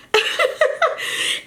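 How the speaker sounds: high breathy burst of laughter; sudden high giggle. Real, not acted. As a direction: laugh at your own mistake. Laughs at own blunder.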